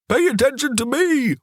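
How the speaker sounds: a dog barking for food, put into words; Solo's demanding bark. A narrator's reading. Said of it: "he would bark his".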